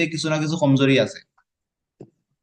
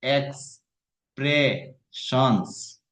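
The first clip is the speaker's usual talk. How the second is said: Expressions said very slowly, syllable by syllable; showing the student how to break the word apart; broken into syllables; much slower than usual. Shown as a demonstration. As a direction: stretch out expressions piece by piece. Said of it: "আমরা ভাঙ্গিয়া ভাঙ্গিয়া যদি কই".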